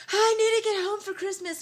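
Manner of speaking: high, loud desperate movie plea; hammed-up pleading impression. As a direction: wail it like a stranded film kid. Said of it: "I felt like J T T".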